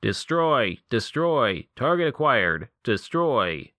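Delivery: mechanical robot yell. Acted. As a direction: bark it like a killer machine. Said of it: "yelled in a mechanical voice".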